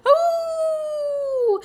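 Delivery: long high howl; high drawn-out yowl of fright; fox howl. A narrator's reading. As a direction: howl like fleeing foxes. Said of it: "made them howl".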